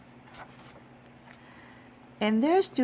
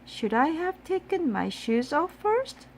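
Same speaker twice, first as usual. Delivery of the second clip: innocent question; 9 semitones higher than usual. A narrator's reading. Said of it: "said Amelia Bedelia".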